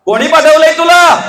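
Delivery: high, loud imperious challenge; furious king interrogating; angry demand. Acted. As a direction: bark it down at the envoy. Ravana demands of Angad.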